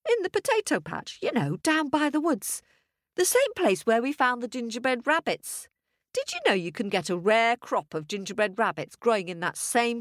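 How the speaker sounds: high, eager explaining; high-pitched village wife telling her tale; chatty farmer's wife. Acted. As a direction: prattle on proudly, pitched high.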